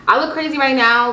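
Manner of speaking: high, loud burst of excitement; excited. Real, not acted. Excited exclamation about her look.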